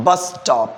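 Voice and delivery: "bus stop" with one shared s; connected words. Shown as a demonstration. Teacher models for repetition, bus stop connected. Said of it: "connect ചെയ്ത് പറയാ".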